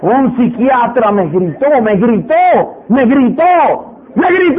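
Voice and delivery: mock grievance building to a high shout; hammed-up indignation, escalating into a shout; obsessive repetition. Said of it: "Me voy a repetir eso el resto de la semana".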